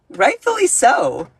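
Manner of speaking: mock-prim echo of her own line; sassy repeat. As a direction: drag out the words with a knowing sass. Repeated in a put-on higher voice.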